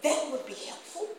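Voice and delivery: hesitant, choked-up mimic of the man; imitating the salesman. Preacher mimicking the salesman's halting thanks, hesitant. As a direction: put on the man's unsure voice. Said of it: "And then he wanna say".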